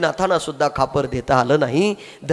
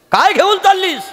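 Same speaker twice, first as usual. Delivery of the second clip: gruff uncle; 14 semitones higher, 5 dB louder than usual. Acted. Angry uncle questions the girl.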